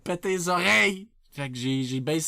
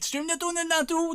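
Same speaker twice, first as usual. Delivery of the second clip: much higher, sing-out delivery; high-pitched catchphrase; 9 semitones higher than usual. Acted. Streamer introducing himself to chat, high hyped voice.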